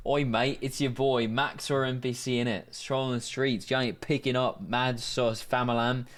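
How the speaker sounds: slangy British lad voice; British NPC accent. Acted. British NPC leaving a voicemail, put-on British accent. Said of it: "N P C with max aura leaves a voicemail".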